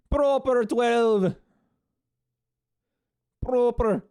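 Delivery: slow, high mock Irish accent; hammed-up Irish accent, drawn out. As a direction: savour the brand name in Irish. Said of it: "How's that Irish accent?".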